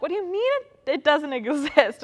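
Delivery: high, incredulous retort with a laugh; mock disbelief. Pastor retelling her reply to a confused student, mock disbelief. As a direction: pitch it up in amused disbelief. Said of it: "And I'm like".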